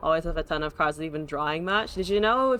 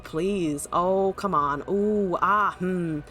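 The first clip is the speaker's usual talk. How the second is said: ordinary talk, nothing marked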